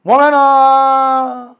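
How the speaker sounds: loud, slow, high call of "Woman"; drawn-out call. Acted. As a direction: stretch the word out, calling loudly.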